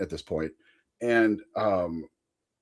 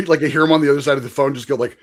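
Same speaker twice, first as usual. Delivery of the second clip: usual speaking voice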